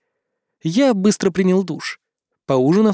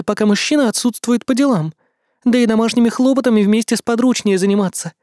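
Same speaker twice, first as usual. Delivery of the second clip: put-on higher, gentle young woman's voice; princess Mei voice; 7 semitones higher, 3 dB louder than usual. A narrator's reading. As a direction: voice her as a sweet young princess. Character voice of Mei.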